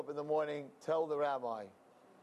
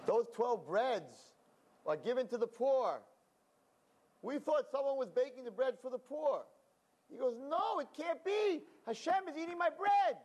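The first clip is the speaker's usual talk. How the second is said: higher, emphatic insisting; insistent; 10 semitones higher than usual. Acted. Man and rabbi arguing over who eats the bread, higher and insistent. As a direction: press the point hard.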